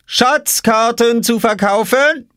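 very high, drawn-out vendor's cry; calling out. A narrator's reading. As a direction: sing out your wares, provocatively slow. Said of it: "rief Oskar hinter seiner Obstkiste".

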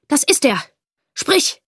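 fest, fallend auf „das“, dann laut befehlend; bestimmter Fremder. Acted. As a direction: der Fremde zeigt auf ihn und fordert. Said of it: "wandte er sich an den Vater". (Fremder aus der Kneipe drängt den Vater, bestimmt)

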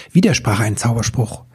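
ordinary delivery

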